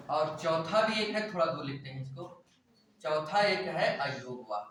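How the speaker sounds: ordinary talk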